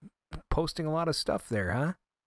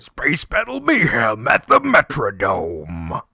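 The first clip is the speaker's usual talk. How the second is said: announcer voice; 8 dB louder, slower than usual. Acted. Mock arena announcement of game.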